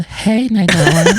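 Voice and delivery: put-on high, slow, sweet girlish voice; auto-tuned little girl voice. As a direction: coo it like a little girl through auto-tune. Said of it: "she said I sound like a little girl".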